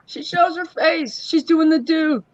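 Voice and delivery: high, slow mocking singsong; high teasing voice. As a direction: tease her in a high voice. Teasing arriving coworker.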